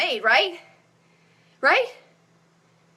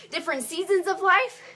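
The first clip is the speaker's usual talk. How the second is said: usual speaking voice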